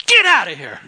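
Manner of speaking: loud barked dismissal; angry judge. Retold or imitated. Judge shouting at the couple to leave. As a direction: throw them out with a shout. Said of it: "judge throws couple out".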